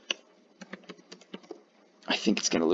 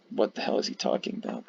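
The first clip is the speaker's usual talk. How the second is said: whispered aside; 9 semitones lower, 5 dB quieter than usual. Pretend. Imagined viewer reaction quote.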